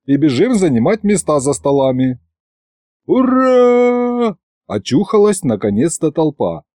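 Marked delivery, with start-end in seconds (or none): 3.1-4.4 high, drawn-out hurrah; crowd cheer. A narrator's reading. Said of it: "Живо орём". (протяжный крик толпы)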